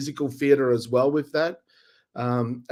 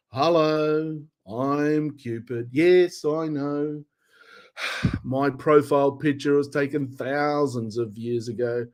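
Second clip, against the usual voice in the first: weary Cupid. Acted. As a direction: sigh through the greeting like a bored Cupid. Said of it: "I'm Cupid ... tired of it".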